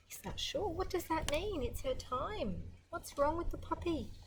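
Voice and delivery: put-on high worried child's questions; worried boy. Boy puzzling over Fluff's time, high worried questions. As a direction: wonder anxiously like a little boy. Voicing Billy's worried thoughts.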